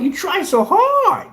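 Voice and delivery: high, slow sing-song taunt; mocking. Taunting rivals who envy his paint, high slow mock voice. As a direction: tease them as pathetic tryhards.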